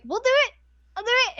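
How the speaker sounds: mock-eager classmates calling out; eager volunteering. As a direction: jump in like keen kids volunteering.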